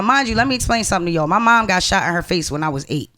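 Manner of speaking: ordinary talk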